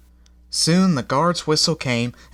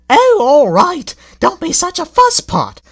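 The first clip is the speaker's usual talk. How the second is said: put-on two old coaches giving in grudgingly; grumbling coaches; 9 dB louder than usual. A narrator's reading. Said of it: "the coaches chimed in unison".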